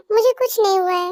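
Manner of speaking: ordinary talk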